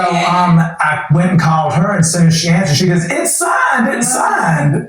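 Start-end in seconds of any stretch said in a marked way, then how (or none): 3.1-4.9 high, crying shout of joy; retold joyful shout. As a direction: scream it through tears. Wife answers phone with news.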